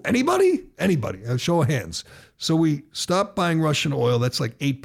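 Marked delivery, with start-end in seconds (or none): 0.0-0.7 high, loud questioning call; rhetorical question. Real, not acted. Host asking listeners for dissenters, high and loud. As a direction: call out to the room, expecting no answer.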